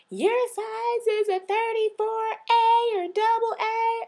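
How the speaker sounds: high, drawn-out sales-worker holler; mock yelling. Store worker announcing a girl's bra size, mock yelling. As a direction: yell it out for the whole store. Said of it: "you wanna yell out".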